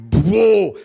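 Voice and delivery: loud shocked whoa; startled whoa. Retold or imitated. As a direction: jolt on impact. Impact of Amy landing.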